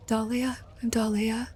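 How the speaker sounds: high, soft, small voice; shy introduction. Acted. Dahlia introducing herself to Ziel, shy and soft.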